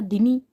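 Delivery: दिनी with a clear इ; correct ending: दिनी. Shown as a demonstration. Teacher fixing the ending of the word, stressing दिनी. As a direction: stress दिनी, not दनी. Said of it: "कुमुदनी होता है".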